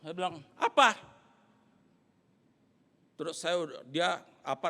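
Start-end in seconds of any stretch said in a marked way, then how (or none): 0.6-1.0 high, very loud retold yell; angry shout back. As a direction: explode into one challenging shout. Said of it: "ini emosi lagi naik". (bentakan emosi, nada tinggi)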